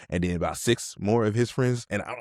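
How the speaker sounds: usual speaking voice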